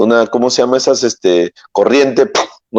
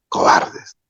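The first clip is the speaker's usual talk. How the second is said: whispered repeat; 5 dB quieter than usual. Shown as a demonstration. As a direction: whisper the word Cobardes. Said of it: "Por qué son tan cobardes". (susurrando Cobardes)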